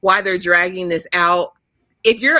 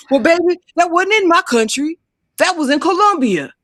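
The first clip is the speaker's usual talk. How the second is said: higher put-on voice, smug and dismissive; mock smug excuse; 7 semitones higher than usual. Cheating man brushing off his girlfriend, smug. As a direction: toss off his excuse like it's nothing.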